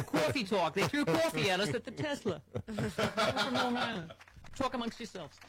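lightly voiced Linda Richmond, laughing through it; a hint of a Long Island talk-show host, sung opening. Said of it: "That was like Linda Richmond calling".

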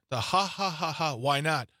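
spoken mock "ha ha" laugh; imitated laughter. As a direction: mimic a dismissive laugh in words. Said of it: "It is the laughter".

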